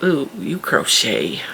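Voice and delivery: a sniffy, condescending "ooh"; snobby store clerk. Retold or imitated. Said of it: "stores that are snobby".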